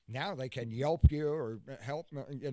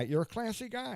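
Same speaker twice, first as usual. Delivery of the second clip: higher put-on voice, dripping with sarcasm; mock-sarcastic; 7 semitones higher than usual. Teasing the guest about his joke, higher sarcastic voice. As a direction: repeat it as a sarcastic jab in a higher voice.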